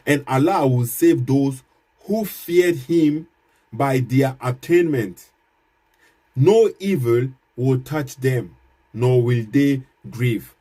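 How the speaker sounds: slow, weighty reading with each word set apart; slow solemn verse reading. Shown as a demonstration. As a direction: read the verse like scripture in church. Rereads verse aloud.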